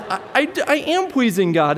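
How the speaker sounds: put-on high, stammering little girl protesting; indignant little girl. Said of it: "And she says".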